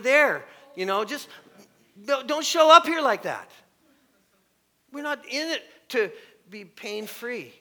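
loud, high mock coach tirade; berating coach. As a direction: yell at the player like a harsh coach.

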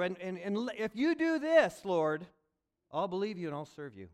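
high, loud bargaining with God; loud bargaining plea. Acted. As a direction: push the deal on the Lord, loudly. Bargaining prayer voiced.

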